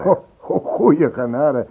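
stammering, slowed startled exclamation; mild flustered surprise; taken aback. Real, not acted. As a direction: catch yourself, stumble over the words. Startled at his own chatter.

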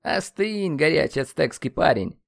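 high, light ribbing; teasing calm-down. A narrator's reading. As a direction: tell a friend to cool off, jokingly. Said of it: "попросил негодующего воина один из друзей".

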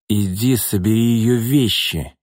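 put-on slow, slurred drunk demand; a drunk ex-wife bossing the new girlfriend around; drunk woman. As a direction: order her about like a tipsy woman. Drunk ex-wife orders her.

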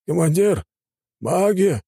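high alarmed shout; shouted warning. A narrator's reading. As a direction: yell to warn the commander. Soldier warns of mages. (тревожный крик)

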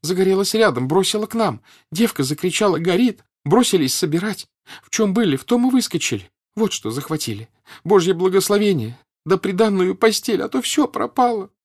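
high, loud, tearful gabble; sobbing patter. A narrator's reading. Woman tells Pierre of the fire, sobbing patter. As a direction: pour it out frantically between sobs. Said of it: "скороговоркой всхлипывая".

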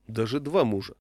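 ordinary delivery

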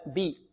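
"بي" with a clean ee vowel; pure kasra. Shown as a demonstration. Teacher models for students, clear ee in "بي". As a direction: make the i of "بي" narrow and pure. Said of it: "هكذا".

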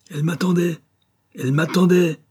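high, loud jealous yelling; furious shout. Acted. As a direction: shout it out, beside yourself. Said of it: "criait-il à qui voulait l'entendre". (crier de rage, voix haute et forte)

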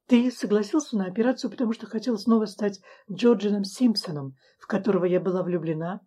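usual speaking voice

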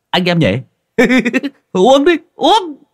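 high, loud laughing call to drink; a drunk buddy's loud laughing toast. Acted. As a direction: whoop it up and urge everyone to drink.

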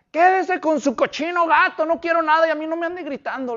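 high, rushed acted indignation; hammed-up angry rant, pitched high; angry outburst. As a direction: play the man who worked himself up into a rage. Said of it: "ya estaba bien enojado".

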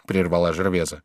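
ordinary delivery

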